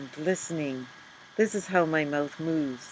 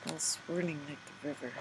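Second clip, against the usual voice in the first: soft, quick murmur; 3 semitones lower, 14 dB quieter, faster than usual. A narrator's reading. Softer echo of swirling.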